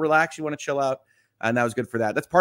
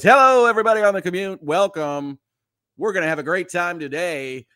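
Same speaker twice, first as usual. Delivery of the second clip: bright, quick DJ patter, higher and louder; morning radio voice; 4 semitones higher, 5 dB louder than usual. Shown as a demonstration. Host greets listeners on their commute, cheery radio DJ voice. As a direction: sell it like a chirpy drive-time host. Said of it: "my morning radio voice".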